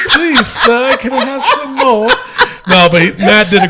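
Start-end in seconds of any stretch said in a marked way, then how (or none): 0.1-2.1 high, laughing British beggar voice; a Dickens urchin begging, in a British accent; mock British accent. Teasing a co-host's interviewer, in a laughing British accent. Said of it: "that British accent".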